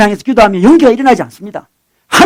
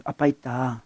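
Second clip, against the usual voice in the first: soft reassurance; 6 semitones lower, 13 dB quieter, slower than usual. Retold or imitated. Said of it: "그렇게 하죠".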